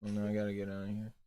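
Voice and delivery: sulky low, soft murmur; mock-dejected, barely bothering; unenthused. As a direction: pretend to be totally drained of enthusiasm. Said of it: "unenthused [name] over here".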